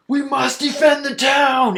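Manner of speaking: high, loud dwarf shout; battle cry. Acted. Dwarf to meddling adventurer, urgent high shout. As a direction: shout it like a frantic defender.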